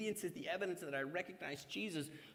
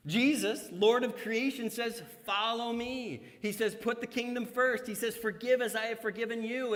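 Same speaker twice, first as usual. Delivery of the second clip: louder, higher ringing proclamation; preacher proclaiming Jesus' commands; preaching; 7 semitones higher, 7 dB louder than usual. Acted. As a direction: preach it out with full force. Preaching at full force.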